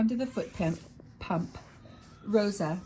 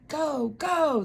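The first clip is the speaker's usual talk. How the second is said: higher, louder cheer; crowd cheering; 8 semitones higher, 5 dB louder than usual. A narrator's reading. The crowd cheering back to the librarian, excited. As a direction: chant it like a crowd. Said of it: "the crowd cheered".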